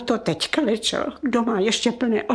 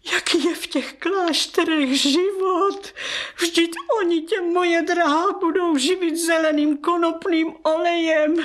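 higher, close to crying; tearful pleading; 5 semitones higher than usual. Acted. Aunt pleads with her niece against the convent, tearful. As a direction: plead through rising tears.

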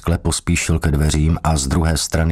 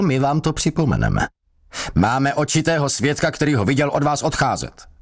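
higher, insistent interrogator; accusing police sergeant; 7 semitones higher than usual. A narrator's reading. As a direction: corner the suspect with every line. (strážmistr tlačí na podezřelého)

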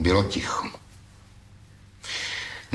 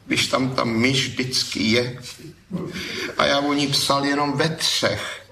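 higher, a bit hurt reply; a touch of a sulky young man explaining; lightly voiced thief, dejected; young thief, downcast; 7 semitones higher than usual. A narrator's reading. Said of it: "Pravil hlas malomyslně".

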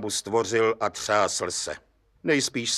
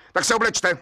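irritable order, pitched high; snapping; 9 semitones higher, 4 dB louder than usual. A narrator's reading. Judge telling the brickmaker to dress, snapping. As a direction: snap the command sharply, voice jumping up. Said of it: "utrhl se soudce na cihláře".

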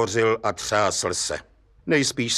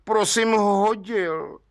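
slow, higher, meek admission; contrite; 9 semitones higher, slower than usual. Acted. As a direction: own up humbly and slowly. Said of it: "řekl provinilec zkroušeně". (soudce se zkroušeně přiznává, pomalu a výš)

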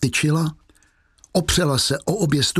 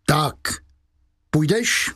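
raised pitch, impatient demand; calling out; 4 semitones higher, slower than usual. A narrator's reading. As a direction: exclaim it as a sharp order. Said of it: "a zvolal".

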